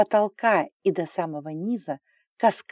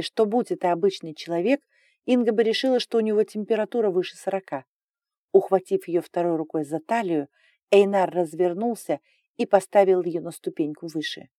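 ordinary delivery

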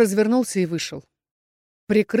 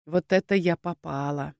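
usual speaking voice

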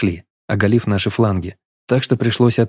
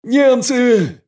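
yelling. Acted. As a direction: shout the warning, bursting in. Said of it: "сходу заорал".

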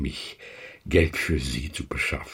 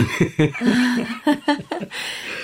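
high cackle. Acted. Lheureux laughs at own joke.

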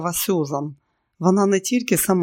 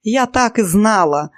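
raised, louder triumphant voice; delighted; 5 semitones higher, 5 dB louder than usual. A narrator's reading. Pyera having her hunch about the spy confirmed, delighted. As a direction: crow it with delight. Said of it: "очі її сяяли".

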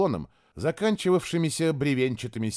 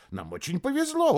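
excited, high; 13 semitones higher than usual. Acted. Excited envoy repeats himself.

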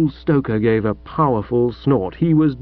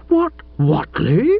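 slow, stunned, rising "What Whatley?"; aghast echo; 7 semitones higher, slower than usual. Acted. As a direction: echo the name in appalled disbelief. Chuffy aghast at insult.

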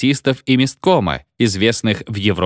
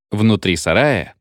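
ordinary delivery, nothing marked

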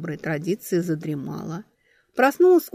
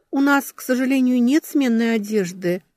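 sincere regret; 7 semitones higher, 4 dB louder than usual. A narrator's reading. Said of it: "с искренним расстройством в голосе".

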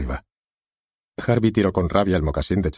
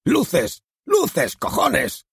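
furious shout; much slower than usual. Acted. Harvey screams for the house lights in fury. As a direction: scream it at the booth.

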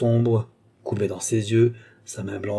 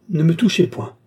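higher, louder warning to keep off; sharp recoiling outcry; 6 semitones higher, 5 dB louder than usual. A narrator's reading. Young woman backing away from her husband's hand, recoiling cry.

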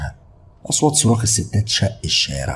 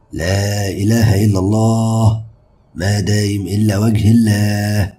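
imam's announcement; 3 semitones higher, 4 dB louder, much slower than usual. Acted. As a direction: intone it slowly like an imam over the loudspeaker. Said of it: "إمام الجامع اتكلم وقال". (نداء إمام بطيء وممدود)

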